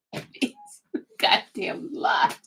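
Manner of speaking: ordinary talk, nothing marked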